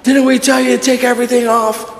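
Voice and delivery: high, sharp drill-sergeant shout; angry sergeant. Retold or imitated. Sergeant confronting the recruit face to face, high angry shout. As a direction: get in his face and demand answers. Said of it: "he's in my face".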